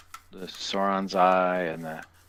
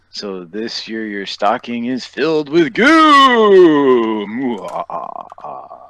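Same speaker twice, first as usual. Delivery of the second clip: sing-song spooky rhyme, getting louder; rhyme reading; 3 semitones higher, 9 dB louder, slower than usual. Pretend. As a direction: read it like a mock ghost. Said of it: "Just read it out loud".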